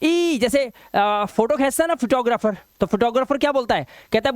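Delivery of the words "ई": "ई" high and held through a smile; long, high smiling "ई". Shown as a demonstration. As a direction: stretch "ई" with smiling lips. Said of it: "ऐसे हंसो".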